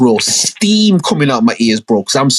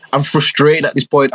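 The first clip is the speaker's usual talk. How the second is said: ordinary talk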